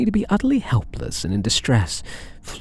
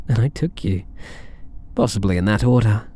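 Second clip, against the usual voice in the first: usual speaking voice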